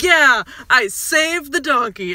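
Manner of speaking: put-on drunken boast. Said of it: "gets drunk and saves a donkey".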